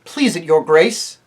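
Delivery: loud, coldly courteous; cold and polite. A narrator's reading. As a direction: answer with icy politeness. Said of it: "very coldly and politely".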